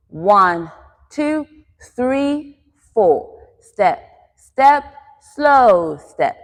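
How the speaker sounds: spaced beats, pitch lifted mid-phrase, dropping to finish; rhythmic dance count. Shown as a demonstration. Dance teacher shows the class the rhythm, counting in time. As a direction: count to a quick-quick-slow beat. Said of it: "in the rhythm of quick, quick, slow".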